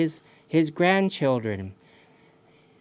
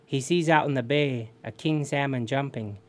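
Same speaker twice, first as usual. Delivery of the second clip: plain reading voice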